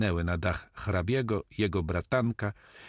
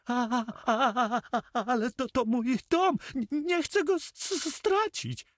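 much higher, stuttering, rising to a squeal; panicked miser. A narrator's reading. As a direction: wail over losing his house, tripping on every word. Notary voiced by narrator. (notariusz piszczy i jąka się z rozpaczy)

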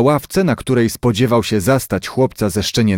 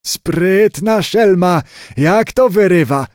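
delighted grandfather, high and drawn out; very pleased; 7 semitones higher, slower than usual. A narrator's reading. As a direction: crow with pride at the clever little rascal. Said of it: "zawołał dziadek Muńka, bardzo zadowolony".

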